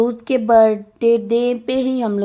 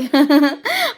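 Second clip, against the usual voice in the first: a short real laugh that rises; burst of laughter; 5 semitones higher than usual. Real, not acted. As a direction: laugh out at the memory. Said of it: "अब बहुत हंस रहे थे हम लोग". (खुलकर हँसी)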